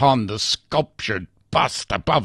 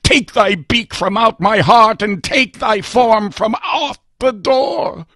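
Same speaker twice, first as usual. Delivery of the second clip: louder, much higher furious outcry; furious shriek; 8 semitones higher, 8 dB louder than usual. Acted. Narrator shrieks at the raven to leave his door, furious and loud. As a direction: banish it with raging despair. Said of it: "I shrieked upstarting".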